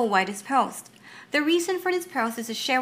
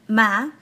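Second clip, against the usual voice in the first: "ma" rising from middle to high; second-tone "ma", sliding upward; 6 dB louder than usual. Shown as a demonstration. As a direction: lift the end of "ma". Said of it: "starts at mid-range and ends high".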